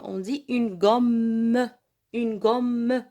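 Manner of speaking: gomme said slowly, loud, the mm held; stressed double m. Shown as a demonstration. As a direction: press the mm of gomme like a shadda. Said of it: "نشدد على الكلمة". (نطق gomme بتشديد الميم ببطء)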